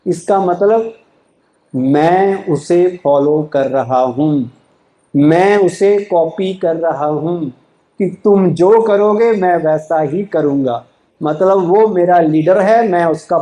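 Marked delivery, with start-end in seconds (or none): 1.7-7.5 slow emphasis. Real, not acted. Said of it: "slow emphasis on the point".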